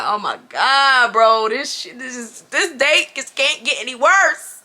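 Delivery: slow, drawn-out mortified groan; slow horrified mutter to yourself; dread-filled groan. Retold or imitated. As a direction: stretch it out like you want to disappear. Despair at a worsening date.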